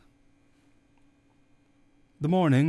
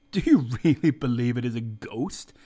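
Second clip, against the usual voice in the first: a louder, scoffing question; mock the idea of a ghost; faintly disdainful, pushed a little louder; somewhat contemptuous; 5 dB louder than usual. A narrator's reading. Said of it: "I inquired somewhat contemptuously".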